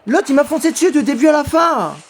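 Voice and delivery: high furious yelling at the game; raging gamer. Real, not acted. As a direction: scream at the screen in rage. Gamer rages at unfair level.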